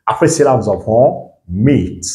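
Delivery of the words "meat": MEAT with the ee stretched; drawn-out long vowel. Shown as a demonstration. Teacher modelling the long sound, MEAT drawn out. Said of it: "On doit un peu tirer là".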